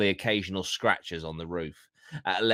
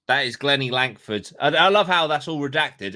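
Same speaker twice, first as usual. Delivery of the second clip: ordinary delivery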